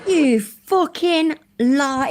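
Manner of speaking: high, loud, drawn-out disbelief; incredulous outburst. Real, not acted. Streamer yelling at the video host in disbelief. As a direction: stretch it out, appalled.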